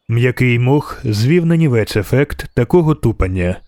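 plain reading voice

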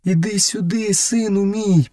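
high, tender mother's call; narrator's high motherly voice; mother calling her son. A narrator's reading. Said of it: "покликала вона".

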